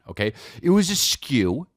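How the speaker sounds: usual speaking voice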